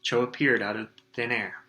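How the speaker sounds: ordinary delivery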